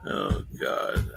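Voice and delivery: breathy whispered oh God; whispered groan. Real, not acted. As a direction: groan it under your breath. Groans at basketball noise.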